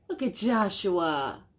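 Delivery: ordinary delivery, nothing marked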